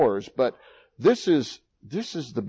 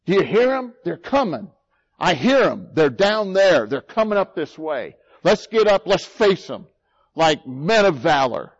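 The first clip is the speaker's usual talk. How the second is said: higher and louder urgent call to rise up; rallying battle call; 5 semitones higher, 6 dB louder than usual. Pretend. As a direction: rouse the troops to face the enemy. Acts soldiers rousing to battle.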